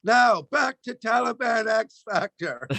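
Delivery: upbeat propaganda announcer; chirpy news read, everything fine; cheery state TV anchor; mock state news. Like the Chinese news.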